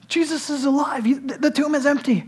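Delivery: high, breathless-news excitement; excited announcement. Acted. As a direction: burst in with astonishing good news. Women running up with resurrection news.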